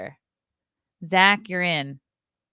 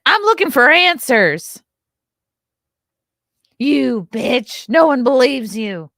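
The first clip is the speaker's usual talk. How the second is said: louder and higher, a hammed-up TV accuser; cheap Nancy Grace voice; 6 semitones higher, 9 dB louder, slower than usual. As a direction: play a tabloid TV host going for the throat. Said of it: "my dollar store Nancy Grace voice".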